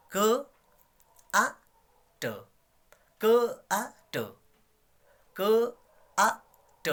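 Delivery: क, आ, ट spaced apart slowly, one sound at a time. Shown as a demonstration. Teacher sounding out क आ ट for the class, slow and separate. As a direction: split क आ ट into separate sounds with pauses. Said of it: "अब मिला के चलो".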